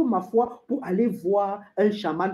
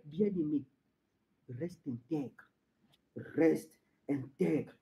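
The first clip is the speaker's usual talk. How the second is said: low, very soft plea; hushed exhortation; 6 semitones lower, 12 dB quieter, slower than usual. Acted. Preacher urging the beloved to stay intact, hushed. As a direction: urge it almost under the breath.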